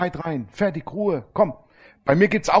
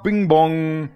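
usual speaking voice

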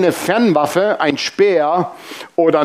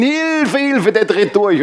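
high mock cry of hilfe; mock panicked cry; 6 semitones higher, slower than usual. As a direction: imitate the roommates shrieking for help. Patients screaming for help.

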